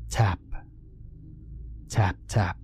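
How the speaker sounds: soft unvoiced tap, tap; whispered taps. A narrator's reading. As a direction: breathe the taps like a faint knock. Tapping sound on the window.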